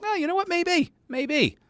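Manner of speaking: high, squeaky mock voice of himself; mock high reaction voice. Imitating his own startled reaction, high and squeaky. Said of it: "I'm like".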